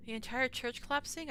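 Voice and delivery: ordinary talk, nothing marked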